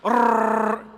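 long buzzing rolled Rrrr; heavy rolled r. Shown as a demonstration. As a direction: trill the R like rumble strips. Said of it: "Y'all do it with me".

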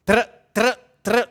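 mouthed three-round burst; mock rifle burst; gunfire sound. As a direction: tra tra tra like a rifle. Imitating burst fire.

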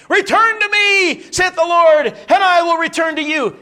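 high, loud, slow pulpit cry; mock pulpit thunder; preacher's thundering call. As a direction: boom it out like a preacher calling the pew.

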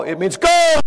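sudden loud high shout of GO; shouted answer. Retold or imitated. Brother in church blurting the answer, loud shout. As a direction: jump up and shout it out. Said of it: "I came up out of my seat".

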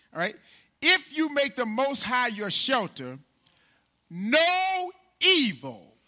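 loud, high preaching build; preaching at full force. Acted. Preacher rousing the congregation with a loud, high voice. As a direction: lift the church with every line.

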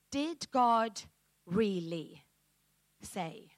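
a quiet, doubting hiss of a question, dropping off at the end; sly serpent voice. Retold or imitated. Preacher voicing the serpent's doubt to the congregation, quiet and sly. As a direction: plant doubt like the serpent in the garden. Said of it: "a voice that says".

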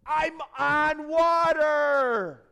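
long, loud, high yell of amazement; astonished full-voiced holler; exultant shout. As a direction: shout it slowly, stretching the last word. Peter exulting on the water.

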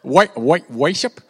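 stumbling, loud fake British accent; badly faked British accent; botched British accent. Shown as a demonstration. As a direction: grope for a British "worship", retrying the first syllable. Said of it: "say it in your best British accent".

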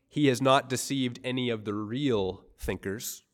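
usual speaking voice

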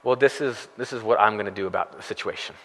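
quick, hushed talking-to-yourself; self-reliant inner voice. Retold or imitated. Pastor voicing a believer's self-reliant thought, hushed and quick. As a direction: mutter a plan to yourself, quickly. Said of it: "do we immediately go to".

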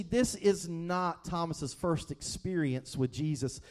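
ordinary talk

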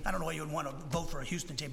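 ordinary delivery

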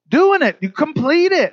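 high, loud punch; preacher driving home the homework point with a high, loud burst; emphatic peak. Real, not acted.

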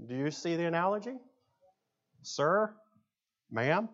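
ordinary delivery

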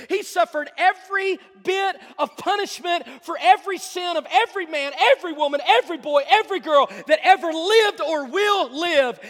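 loud, high, driving pulpit delivery; full-force preaching. Acted. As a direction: preach it hard, building to a cry on each "every".

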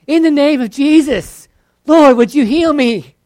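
loud, high prayer; mock prayer, loud and high; Jesus name prayer. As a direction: put the name first. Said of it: "Do you say it before".

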